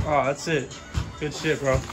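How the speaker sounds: ordinary delivery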